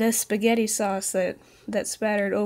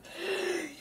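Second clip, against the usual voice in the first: mock gasp; 10 semitones higher, 7 dB quieter than usual. Mock shock at pasta.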